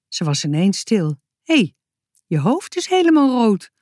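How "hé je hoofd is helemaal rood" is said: verbaasd. A narrator's reading. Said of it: "vroeg ze verbaasd".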